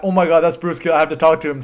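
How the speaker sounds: breathless fan excitement; starstruck excitement. Retold or imitated. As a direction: gush like a fan spotting his hero. Starstruck at seeing his hero.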